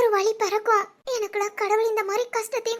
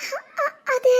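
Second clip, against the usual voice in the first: fearful stammer; 3 semitones higher, much slower than usual. Acted. Frightened wife cannot answer husband.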